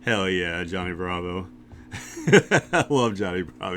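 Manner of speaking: normal talking voice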